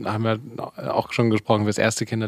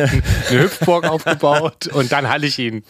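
laughing; 4 semitones higher, 4 dB louder than usual. Real, not acted. Joking over partner's story.

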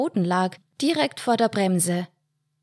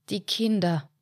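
usual speaking voice

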